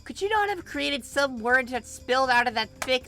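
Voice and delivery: put-on high, loud tirade of an older sister; furious, shouting older sister; furious scolding. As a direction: tear into them, word by word. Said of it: "each sentence and harsh word".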